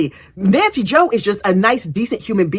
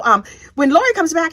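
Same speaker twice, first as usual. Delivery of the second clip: ordinary talk, nothing marked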